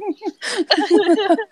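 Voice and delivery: long, high, helpless laugh; silly-sounding laugh. Real, not acted. Listener losing it at co-host's joke, high helpless laughing. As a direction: crack up for real in a high pitch.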